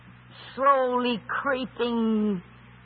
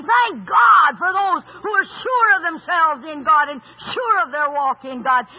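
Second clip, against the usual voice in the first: shouting praise; 3 semitones higher, 5 dB louder than usual. Acted. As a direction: proclaim it with full force.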